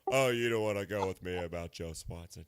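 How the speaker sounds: low, put-on cartoon voice; cartoon character voice. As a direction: drop into a deep cartoon voice. Family Guy impression.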